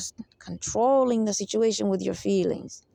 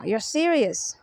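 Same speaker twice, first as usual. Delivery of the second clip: normal talking voice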